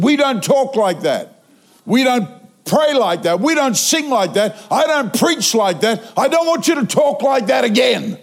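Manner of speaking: rising, emphatic scolding; on the attack. Retold or imitated. Preacher attacking the man for how he talks, fierce scolding. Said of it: "So I went on the attack".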